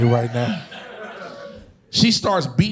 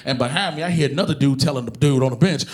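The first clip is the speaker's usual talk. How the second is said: usual speaking voice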